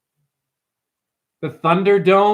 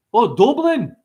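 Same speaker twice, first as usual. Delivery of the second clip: mock Irish accent on Dublin, raised pitch, "Doo-blin"; high, playful "Doo-blin" in a put-on Irish accent; Scottish-Irish accent; 6 semitones higher than usual. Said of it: "I go to Scotland accent".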